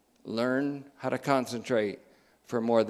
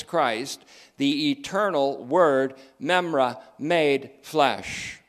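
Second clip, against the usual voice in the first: louder, measured proclamation; emphatic preaching; 4 semitones higher, 6 dB louder than usual. Real, not acted. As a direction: preach it out, word by weighty word.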